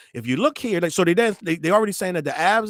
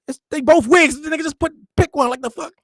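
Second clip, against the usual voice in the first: incredulous shout; 9 semitones higher, 5 dB louder than usual. Real, not acted. Incredulous rant about the wigs.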